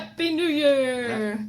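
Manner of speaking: slow stretched mock greeting; cheesy announcer. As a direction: ham up a New Year cheer. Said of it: "Terrible, terrible".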